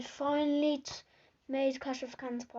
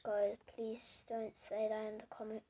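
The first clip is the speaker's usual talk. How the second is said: normal talking voice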